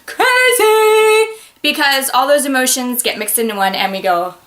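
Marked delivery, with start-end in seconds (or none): 0.0-1.3 high, sing-song craziness; hammed-up comic mania; mock crazy. Shown as a demonstration. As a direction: draw it out in a loud, loony singsong. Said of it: "that next emotion that follows is".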